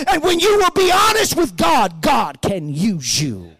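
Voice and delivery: loud, high preaching shout; full-force preaching. Real, not acted. Preacher exhorting the congregation, shouting. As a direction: drive the point home to the church.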